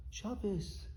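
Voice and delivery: murmured softly, slowly; hushed, tender retelling. Retold or imitated. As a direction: say it gently, as if comforting. Tender retold scene, rubbing his hand.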